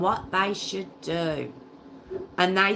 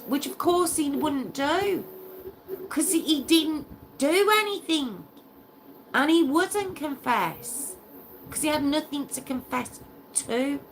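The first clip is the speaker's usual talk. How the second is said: outraged indignation; 10 semitones higher than usual. Real, not acted. Host defending the innocent victim, outraged and high. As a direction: protest his innocence, pitch soaring.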